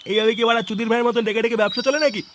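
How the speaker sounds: usual speaking voice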